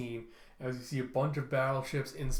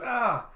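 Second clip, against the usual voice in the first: short, high, loud "ah!"; startled scream; 9 semitones higher, 6 dB louder than usual. Acted. Character seeing red eyes in a crater, startled scream. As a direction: cry out high in sudden fright.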